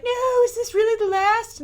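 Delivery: high, slow, hammed-up despair; mock dramatic dismay. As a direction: wail it like a soap opera. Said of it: "you're supposed to be like".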